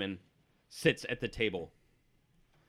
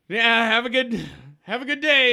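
loud, high, over-bright send-off; forced loud farewell from a local; dismissive locals; 11 semitones higher, 11 dB louder than usual. Acted. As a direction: brush someone off in a raised voice. Locals awkwardly brushing off a player.

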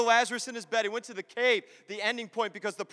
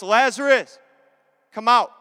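much louder, higher call to summon someone; loud commanding call; 4 semitones higher, 12 dB louder than usual. Acted. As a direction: call him out with full force. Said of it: "Jesus called in a loud voice".

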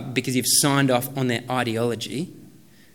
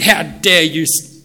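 slow, louder mock indignation, broken off; mock outrage; 3 semitones higher, 10 dB louder, slower than usual. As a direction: pretend to blow up, then stop short. Joking stern Jesus, cut off.

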